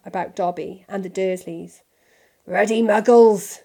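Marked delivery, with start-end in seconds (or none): 2.5-3.6 loud, angry growl; forceful grumbling outburst. A narrator's reading. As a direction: growl it out in disgust. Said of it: "growled Hagrid".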